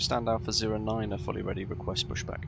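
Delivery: usual speaking voice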